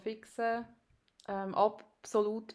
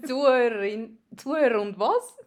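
surprised; 3 semitones higher, 10 dB louder than usual. Real, not acted. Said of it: "puzzled by the odd form".

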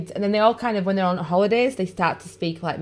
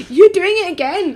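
high, loud laughing protest; 11 semitones higher, 8 dB louder than usual. Real, not acted. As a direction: laugh it out at him. Laughing at partner's repeated phrase.

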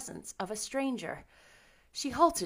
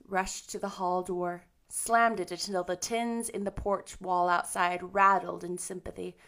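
plain reading voice

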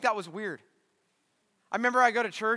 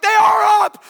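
higher and louder, desperate; loud retold frantic protest; panicked shout; 13 semitones higher, 15 dB louder than usual. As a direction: yell back in panic. Panicked reply to cop.

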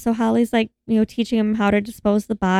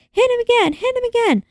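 urging; 13 semitones higher, faster than usual. Retold or imitated. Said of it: "And so Holly's like".

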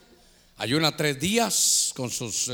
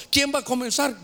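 high rallying question; 7 semitones higher than usual. Shown as a demonstration.

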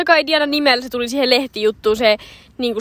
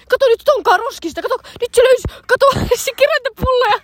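high, giggly yelling; much higher mock shout breaking into laughter; imitated shouting; 10 semitones higher, 3 dB louder than usual. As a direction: mimic a friend loudly pointing someone out. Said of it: "siis se oikeesti huuti".

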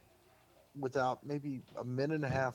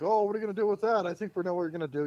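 high, loud and fast teasing; hyped-up hosts egging him on; excited radio hosts; 7 semitones higher, 7 dB louder, faster than usual. Retold or imitated. Hosts mocking the watermelon.

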